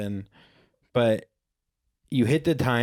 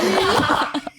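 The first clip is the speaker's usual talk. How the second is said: high squeaky laugh; 4 dB louder than usual. Real, not acted. Laughing at his own voice.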